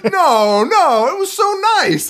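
high, cheery mock voice, far above her own; airily insisting it was lovely; naive friend. Imitating the friend who saw only the bright side, high and sunny. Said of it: "she was like".

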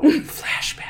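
unvoiced eerie whisper; whispered trailer cue. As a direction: breathe it like a trailer.

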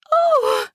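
high gasped "oh"; a startled, high intake and cry. Acted. Wife as the neighbor enters, gasping. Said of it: "I gasped as Ben entered our house".

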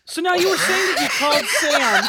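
loud, breathless after laughter; after laughing hard. Real, not acted. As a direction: push through the giggles. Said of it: "Oh, cheeks hurting".

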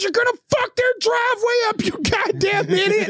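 high-pitched imitation of the angriest worker; outraged, insulting holler; yelling. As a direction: scold in a furious mock yell. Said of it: "the big dude that was yelling at me the most".

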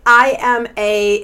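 normal talking voice

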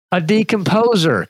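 telling a dad joke. Acted. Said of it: "dad joke time".

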